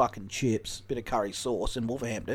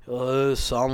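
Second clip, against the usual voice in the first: put-on Wolverhampton accent; 5 dB louder than usual. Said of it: "You can't understand a word he says".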